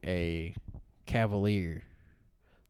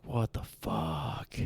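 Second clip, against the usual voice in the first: stunned disbelief; 6 semitones lower, 6 dB quieter, much slower than usual. Retold or imitated. Engine dies in water.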